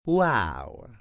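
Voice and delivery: ordinary talk, nothing marked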